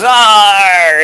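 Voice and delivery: loud, high, drawn-out mock scream; hammed-up dying-creature cry; imitated sphinx scream. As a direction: wail like a defeated monster. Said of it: "And the sphinx goes".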